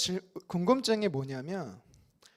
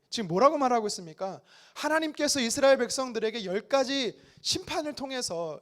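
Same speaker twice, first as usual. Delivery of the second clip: ordinary talk, nothing marked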